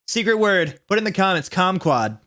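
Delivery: ordinary delivery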